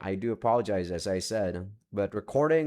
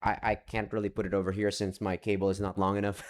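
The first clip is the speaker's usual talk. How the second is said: ordinary talk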